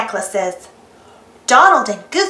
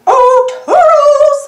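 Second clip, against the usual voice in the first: much higher and louder slow cartoon call; Mickey calling Toodles; 12 semitones higher, 6 dB louder than usual. Mickey calls Toodles for help, high, loud, drawn out. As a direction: sing out for the helper in a high mouse voice. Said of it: "Mickey calls".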